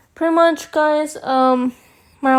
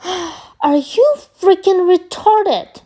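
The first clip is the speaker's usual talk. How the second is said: drawn-out, higher-pitched incredulous question; retold exasperated outburst; 5 semitones higher, slower than usual. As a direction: act out losing patience, stretching the words out. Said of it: "I'm already yelling at her".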